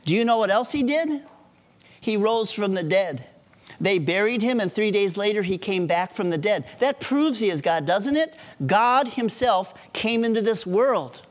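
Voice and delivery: high, emphatic retold testimony; excited conviction.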